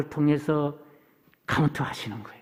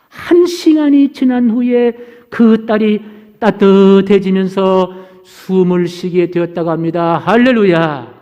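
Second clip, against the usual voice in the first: slow, stretched, louder pulpit delivery; emphatic preaching; 2 semitones higher, 9 dB louder, slower than usual. Real, not acted. Preacher telling the congregation the daughter breathed, emphatic and slow. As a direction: draw out the miracle for the congregation.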